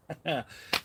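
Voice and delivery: brief high chuckle; short laugh. Real, not acted. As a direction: laugh lightly to yourself. Laughs at own quote.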